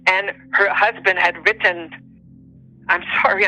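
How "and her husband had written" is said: ordinary talk, nothing marked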